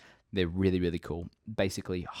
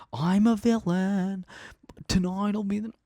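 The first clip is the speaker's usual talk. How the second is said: hammed-up villain; 12 semitones higher, 5 dB louder than usual. Vlogger playing a future villain kid, hammed high boast. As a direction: ham it up like a cartoon villain.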